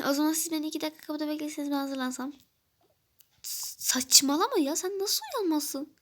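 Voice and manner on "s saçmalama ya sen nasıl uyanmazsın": high, slow indignant telling-off; drawn-out disbelieving scold; exasperated scolding. Acted. Friend scolding at the door. (kapıdaki arkadaş, yavaş ve sitemli azar)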